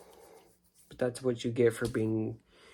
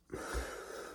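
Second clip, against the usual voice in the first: weary sigh; 13 dB quieter than usual. Real, not acted. Pause before answering own question.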